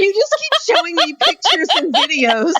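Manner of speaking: high-pitched exasperated complaint; half-laughing protest at her co-host. Real, not acted. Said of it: "this isn't very good for a podcast".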